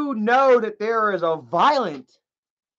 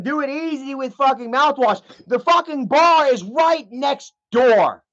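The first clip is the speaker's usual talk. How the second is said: worked-up, raised-pitch rant; real yelling; 5 semitones higher than usual. Real, not acted. Streamer arguing the kids are at risk, yelling. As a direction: drive it home, voice pitched up. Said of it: "Sorry, I'm yelling".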